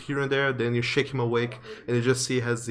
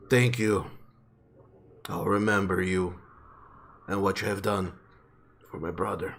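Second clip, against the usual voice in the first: simple-spoken giant; 5 semitones lower than usual. Acted. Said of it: "simple speak".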